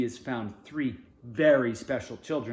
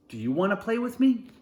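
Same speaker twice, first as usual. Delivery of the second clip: high, louder hopeful ask; eager question; 8 semitones higher, 5 dB louder, faster than usual. Acted. Sebastian asking the boy to play, eager and high. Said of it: "Sebastian says".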